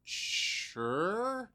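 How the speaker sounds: high, soft put-on voice; mock reply. Answering the fan's request, high and soft. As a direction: chirp a cheerful yes. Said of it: "And I'm like".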